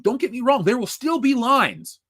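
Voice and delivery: ordinary delivery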